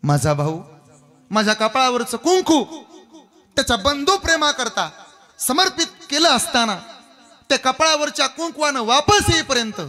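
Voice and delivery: high, loud, anguished plea; impassioned Urmila. Acted. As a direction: cry out Urmila's plea to Rama with full force. Urmila pleads, voice raised high. (उर्मिलेची उंच, जोरात, व्याकुळ विनवणी)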